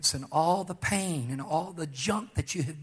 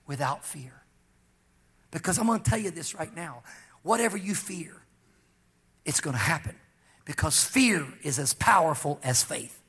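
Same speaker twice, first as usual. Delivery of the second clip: ordinary talk, nothing marked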